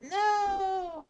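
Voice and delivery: high loud shocked no; dismayed shout. Real, not acted. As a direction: cry out in dismay. Elliptical suddenly stopped.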